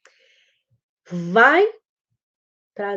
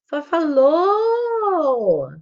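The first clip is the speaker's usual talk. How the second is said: put-on very drawn-out, high, loud toddler exclamation; high sing-song toddler call; small child's babbled prosody; 8 semitones higher, 7 dB louder, much slower than usual. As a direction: stretch "Fafalô" long and high like a toddler attempting a word. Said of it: "a questão da prosódia".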